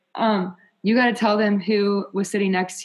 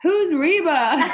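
higher, louder and slow; loud clueless question; 9 semitones higher, 6 dB louder, slower than usual. Retold or imitated. [name] loudly asking who [name] is in the store, clueless loud question. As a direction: blurt it out, oblivious to everyone.

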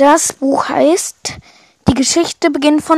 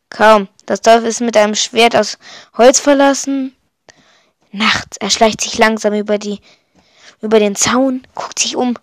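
ordinary delivery

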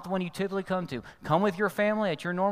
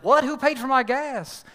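shocked, higher and louder outburst; indignant surprise; 9 semitones higher, 8 dB louder, slower than usual. Retold or imitated. Angry driver finds his gas already paid, indignant shout. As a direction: demand to know who did it.

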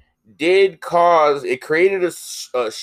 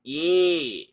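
Kiwi accent; much slower than usual. Shown as a demonstration. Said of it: "That's the best I got".

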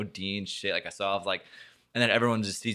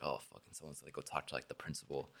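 much softer, fast muttered worry; worried inner thought; 6 semitones lower, 16 dB quieter than usual. Retold or imitated. Student seeing the bad note arrive, fast anxious muttering. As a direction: mutter it quickly under your breath.

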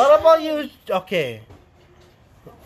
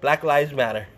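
usual speaking voice

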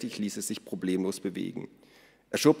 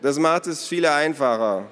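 put-on raised, loud boy's call; gerufen; 7 semitones higher, 7 dB louder than usual. A narrator's reading. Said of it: "rief Naru". (hoch und laut gerufen)

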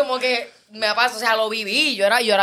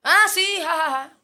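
high, dismissive fake laughter; 8 semitones higher than usual. Brushing off a persistent admirer, high dismissive fake laugh. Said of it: "un día yo le contesté como que".

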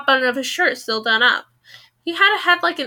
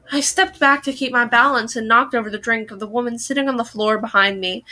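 ordinary delivery

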